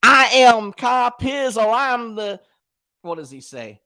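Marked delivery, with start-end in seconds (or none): 0.0-2.4 slow, loud, mocking boast; big slow show-off shout; imitating Kyle Pizzle. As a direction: boom out a drawn-out hammed-up brag. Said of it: "He says it like this".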